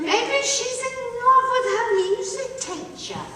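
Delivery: high gossipy whisper of rumour; gossiping courtiers. Retold or imitated. Tour guide voicing gossiping courtiers. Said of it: "They said".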